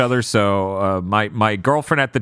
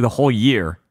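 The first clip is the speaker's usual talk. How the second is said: usual speaking voice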